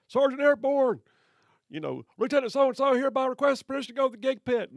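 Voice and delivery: high, loud, eager recruit shouting his request; eager formal request. Retold or imitated. Marine shouting his request to the sergeant, eager and formal. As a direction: bark it out like a gung-ho Marine.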